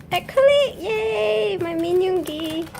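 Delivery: high, loud, delighted cheer; real burst of joy, pitched high; happy cheer. Real, not acted. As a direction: cheer loudly at getting a favorite. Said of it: "I'm so happy".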